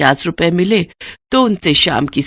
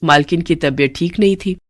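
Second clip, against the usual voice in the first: plain reading voice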